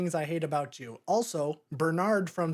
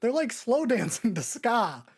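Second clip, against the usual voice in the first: much higher, laughing disbelief; incredulous laughing why, pitched up high; baffled; 7 semitones higher than usual. Retold or imitated. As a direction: squeak out the question through a laugh. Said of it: "I'm like".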